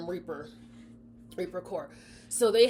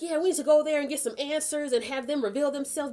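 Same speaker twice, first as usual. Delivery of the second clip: much higher, fast angry demand; higher fast demanding crowd; 9 semitones higher, 6 dB louder, faster than usual. Retold or imitated. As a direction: demand answers like the mob. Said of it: "they're like".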